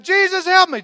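high, loud desperate plea; crying out for help. Retold or imitated. Sinking Peter cries out to Jesus for help. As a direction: cry out as you sink. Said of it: "He cried out".

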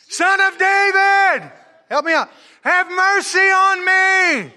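high, loud, slow shouting plea; crying out. Acted. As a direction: yell it out long and desperate. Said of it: "Come on, everybody help me".

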